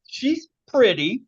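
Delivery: high, slow, rising she's pretty; mock prim. Shown as a demonstration. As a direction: say it all prim and polite. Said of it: "contrasting pretty with smoking hot".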